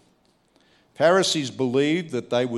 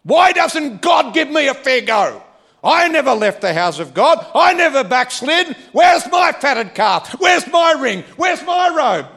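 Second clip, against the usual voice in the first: much higher, louder aggrieved shouting; raised voice; 13 semitones higher, 9 dB louder than usual. Acted. Churchgoer complaining God never rewards him, shouting. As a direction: demand your fatted calf from God. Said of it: "I'm raising my voice".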